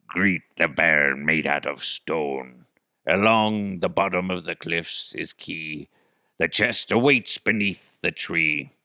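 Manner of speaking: hammy pirate reading a treasure poem. Pretend. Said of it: "if I read it as a pirate".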